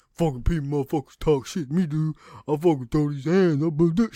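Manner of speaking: ridiculing impression of empty threats; mock tough guy. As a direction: mimic a wannabe fighter running his mouth. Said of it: "You do not sound tough".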